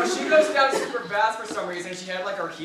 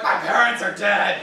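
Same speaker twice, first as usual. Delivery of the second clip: louder, drawn-out dramatic cry; mock Batman wail; 3 semitones higher, 7 dB louder than usual. In the closet playing Batman, a hammed-up loud wail. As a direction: ham up the orphaned-hero line. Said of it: "I'm like".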